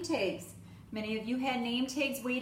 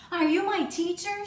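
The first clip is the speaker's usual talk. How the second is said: put-on higher, louder childlike question; little girl asking; 6 semitones higher, 7 dB louder than usual. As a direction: ask like an eager small child.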